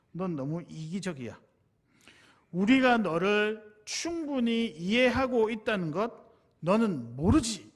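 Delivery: slow, emphatic accusation, building loud and high; retold shout. Friend accusing the speaker of not noticing, retold shouting. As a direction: act out a friend's outburst, word by word. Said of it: "갑자기 저한테 소리를 지르면서".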